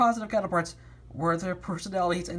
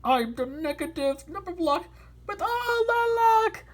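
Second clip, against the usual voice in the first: high, slow boastful brag; boasting; 12 semitones higher than usual. Acted. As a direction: gloat over the luck. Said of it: "boasted negative thirteen".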